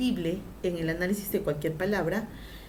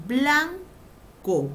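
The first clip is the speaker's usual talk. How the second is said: blanco drawn out as BLAAN... co, high; split into syllables; 5 semitones higher, 4 dB louder than usual. Shown as a demonstration. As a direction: hold BLAN in blanco, pause, then a separate co. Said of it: "Primero vamos a pronunciar".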